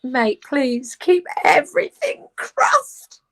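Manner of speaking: slow, rising, drawn-out pleading; excited plea. Real, not acted. Actress hoping for a soap role, pleading excitedly to her friend.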